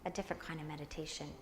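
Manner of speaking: soft, hushed meditation guide, half whispered; guided meditation. Acted. Teacher guiding the class into meditation, soft hushed voice.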